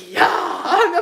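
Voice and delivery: loud, high re-enacted cheer; over-the-top celebratory yell; freaking out excitedly. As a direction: burst out in excitement. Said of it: "freaking out".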